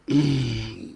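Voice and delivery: low, soft, hesitant "mm"; reluctant low hum. Acted. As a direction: hum quietly, stalling before an answer. Reluctant youth answers the call.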